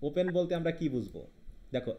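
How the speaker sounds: ordinary talk, nothing marked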